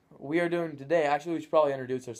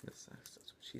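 whispering the punchline under his breath; whispered aside; 25 dB quieter than usual. Acted. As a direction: slip it in as a sly whisper. Running joke, whispered.

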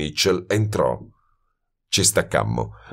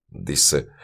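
plain reading voice

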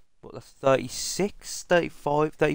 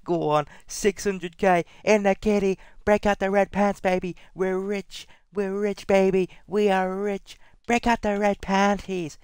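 high-pitched excited cheering; elated celebration; 9 semitones higher than usual. Real, not acted. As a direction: crow over a jackpot win, voice way up high. Celebrating getting rich from pack.

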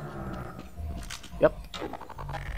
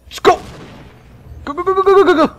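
much higher, loud urgent chant; urging himself on in a real frenzy; frantic go go go; 6 dB louder, faster than usual. Real, not acted. Desperate run through gunfire.

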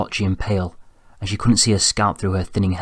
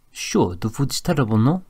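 put-on casual French-accented tag question; 4 semitones higher than usual. A narrator's reading. Pierre, the accented Frenchman, replies.

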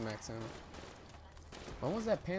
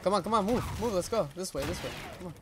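frantic urging, higher pitch; being chased; 7 semitones higher than usual. Real, not acted. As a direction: rush them out, breathless and urgent. Fleeing zombies, urging teammate.

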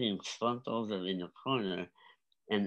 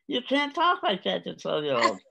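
high, loud mock protest; mock scolding; 10 semitones higher, 7 dB louder than usual. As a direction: scold as if scandalised, voice pitched up. Voices a scolding objection.

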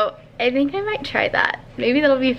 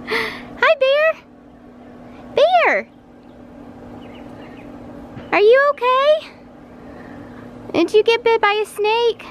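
worried parent; 12 semitones higher than usual. Real, not acted. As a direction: call out to the hurt puppy, concerned. Calling to injured dog.

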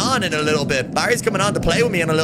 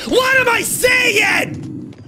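very high, drawn-out yell; slow, incredulous high-pitched shout; disbelieving shout; 4 dB louder, much slower than usual. Real, not acted. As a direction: stretch it into a loud, high scream of disbelief.

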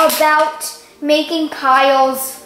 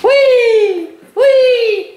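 zombie; 7 semitones higher, 5 dB louder than usual. Shown as a demonstration. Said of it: "Yes, I'm pretty sure".

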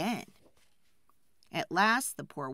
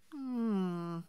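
usual speaking voice

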